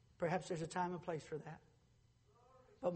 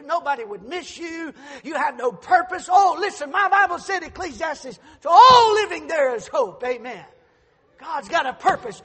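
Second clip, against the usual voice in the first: shouted, ringing preacher's cry; preaching at full force; 7 semitones higher, 16 dB louder than usual. Acted. Pastor thundering at the congregation, shouting. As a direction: preach it out loud to the whole church.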